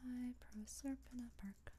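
soft breathy whisper; whispering to self. Real, not acted. Writer reading her episode notes to herself, whispered. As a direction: whisper it under your breath.

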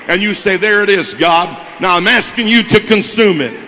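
building from firm to loud, driving pulpit preaching; preaching at full force. Real, not acted. Pastor pressing the church toward the altar, loud preaching. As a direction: preach it to the congregation, rising to a loud climax. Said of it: "I feel like preaching here a second".

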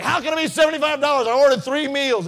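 high, loud, laughing protest; mock outraged yell. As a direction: shout in disbelief at the bill. Dad protesting the huge bill.

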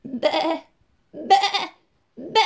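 short high bleats; little bleating goat cries. A narrator's reading. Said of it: "bêla Alfred".

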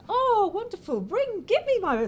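high-pitched, gushing grandmother; gushing greeting. Acted. Ogre grandmother greets family, asking for the baby, gushing. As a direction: coo with eager delight, voice way up.

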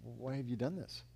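low, very soft reproach; hushed, subdued accusation; hurt question. Acted. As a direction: ask it quietly, as if wounded. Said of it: "look at you one day and say".

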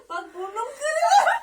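high, slow laughing whine; real laughter breaking up the words; laughing protest. Real, not acted. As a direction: complain loudly through helpless giggles. Laughing protest during the penalty.

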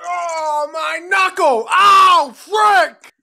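high, loud cry of pain; pain yell. Acted. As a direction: howl as if you just hurt your knuckle. Cry of pain after punching.

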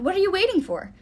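high, loud urgent demand; bellowing. A narrator's reading. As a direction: urge them on with a bellow. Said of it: "he bellowed".

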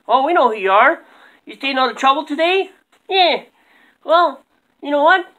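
high squeaky puppet voices; chirpy puppet chatter up high; squeaky ventriloquist dummy. Acted. Dummies greet J T.

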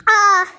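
high, loud "Ah!"; startled scream. Acted. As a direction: scream as the bomb hits. Hit by itching powder bombs.

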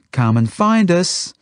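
high, drawn-out call to the chickens. A narrator's reading. Zelda to the chickens, hiding in straw, calling out. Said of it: "Zelda calls to the chickens".

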